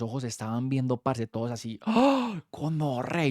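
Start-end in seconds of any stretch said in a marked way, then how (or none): 1.9-2.4 amazed high gasp; wide-eyed gasp of wonder; awed gasp. Retold or imitated. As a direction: gasp like seeing magic. Awestruck gasp at the Palm.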